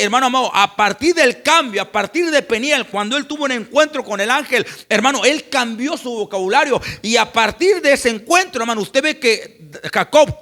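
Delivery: loud, rising preacher's exhortation; loud preaching to the congregation about blessing; fiery preaching. Acted. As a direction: stir up the congregation at full voice. Preaching at full force.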